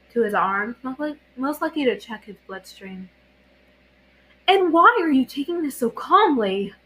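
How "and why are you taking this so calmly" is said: rising, louder, alarmed question; frantic. A narrator's reading. Katara frantic at Zuko's calm. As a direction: push it up into frantic worry. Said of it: "her voice taking on a frantic edge".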